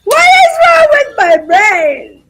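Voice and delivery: high, very loud, slow cry; exasperated wail. Real, not acted. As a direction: wail it out in frustration at yourself. Exasperated at own memory lapse.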